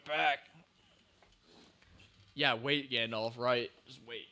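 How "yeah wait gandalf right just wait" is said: ordinary delivery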